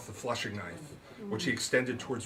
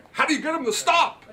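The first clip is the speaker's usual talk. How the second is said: much higher, louder, startled; Wixon's alarmed question; 9 dB louder than usual. A narrator's reading. Wixon shouts over the yelping dog, high and alarmed.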